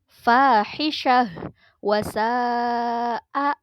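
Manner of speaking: fahisyah wa sa'a chanted slowly with a long drawn final vowel; tajwid recitation. Shown as a demonstration. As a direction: draw out fahisyah wa sa'a like Quran reading. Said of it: "pada lafal". (fahisyah wa sa'a dilantunkan panjang sesuai tajwid)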